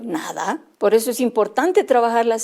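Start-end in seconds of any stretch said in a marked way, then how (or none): 0.0-0.6 softer, lower little reply; child's small answer. Retold or imitated. Child tells the teacher he understood nothing, softly. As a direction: mumble it like a shy child.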